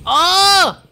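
high, loud mock scream of pain; pretend pain scream. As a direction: yelp as if set on fire. His character catches fire.

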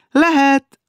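high, loud singsong invitation; cheerful call. A narrator's reading. Maud admits the prince, calling cheerfully. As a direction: call out gaily to come in. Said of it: "Azután vidám hangon kiáltott".

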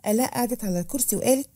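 usual speaking voice

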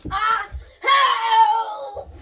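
shouted playful help; mock cry for help. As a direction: yell for help in mock panic. Pretend panic during shot.

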